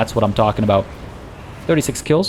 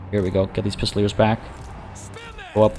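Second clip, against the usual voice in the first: usual speaking voice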